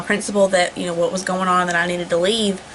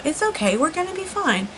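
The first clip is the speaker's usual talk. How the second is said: calm reassurance; 7 semitones higher than usual. Retold or imitated. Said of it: "Justin being calm".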